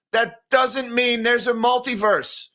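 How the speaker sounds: slow, high, emphatic protest; exasperated insistence. Real, not acted. Host scolding a tech firm's claim, exasperated. Mock rebuke of company's claim.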